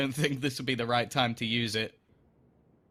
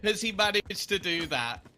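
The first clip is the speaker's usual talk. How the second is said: esports caster; 8 semitones higher than usual. Real, not acted. Caster calls four kills.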